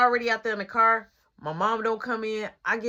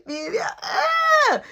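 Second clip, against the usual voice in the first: much higher, drawn-out excited cry; 5 dB louder, much slower than usual. Retold or imitated. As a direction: swoon over the girl. Reacting to girl waving.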